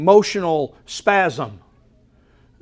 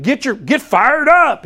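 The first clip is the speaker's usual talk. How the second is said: higher, louder pep-rally cry; mock hype; 6 semitones higher, 4 dB louder than usual. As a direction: fake fired-up cheerleader. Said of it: "He's not saying that".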